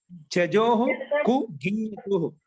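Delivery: slow, firmly spaced sutra; sutra recitation. Shown as a demonstration. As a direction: chop the sutra into slow, separate pieces. Repeats sutra once more.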